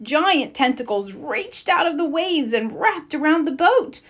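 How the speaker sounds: usual speaking voice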